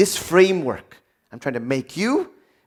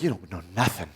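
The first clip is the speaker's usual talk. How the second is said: mocking know-it-all; 9 semitones lower, 7 dB quieter, faster than usual.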